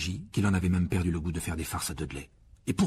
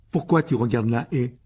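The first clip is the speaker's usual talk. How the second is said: louder suspicious demand; 2 semitones higher, 6 dB louder than usual. A narrator's reading. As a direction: ask like you smell a trick. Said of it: "d'un air soupçonneux".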